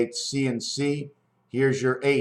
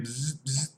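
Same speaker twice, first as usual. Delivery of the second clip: soft imitated electrical buzzing; two short, quiet voiced zaps; 10 dB quieter than usual. Shown as a demonstration. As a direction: buzz twice like a plugged-in light bulb. Imitates a plugged-in light bulb buzzing.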